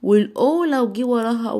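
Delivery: usual speaking voice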